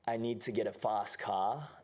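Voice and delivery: slow Australian accent, open "fast cah". Shown as a demonstration. Actor demonstrating for the interviewer, Australian accent. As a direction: say it Aussie, dropping the R in "car". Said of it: "avec l'accent australien".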